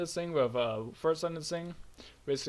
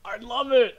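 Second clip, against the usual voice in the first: high, loud, giddy pride; emotional high; 6 semitones higher, 7 dB louder than usual. Real, not acted. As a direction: gush loudly over your own achievement. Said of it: "on an emotional high right now".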